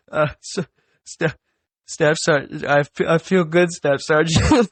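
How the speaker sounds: a frightened recruit stumbling over his words; shaky stammering recruit. Retold or imitated.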